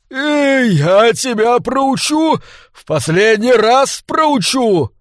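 slow, high-pitched hysterical threat; shrill squeal. A narrator's reading. Crazed maître d' threatens to teach a lesson, squealing. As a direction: screech it with drawn-out, squealing vowels. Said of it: "для нового визга".

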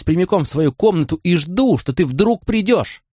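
understated anger of a hurt woman; contained fury. A narrator's reading. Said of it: "ярость в её голосе утихла".

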